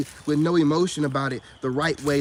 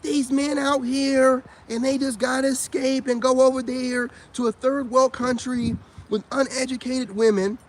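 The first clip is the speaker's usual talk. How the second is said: mocking women; 8 semitones higher than usual. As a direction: drag it out in a higher mocking tone. Mocking women in other videos.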